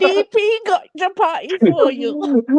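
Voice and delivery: high, slow mock Indian accent; put-on Indian accent. Shown as a demonstration. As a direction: draw it out in a hammed-up Indian accent. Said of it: "Can you do an accent?".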